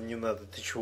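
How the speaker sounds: ordinary delivery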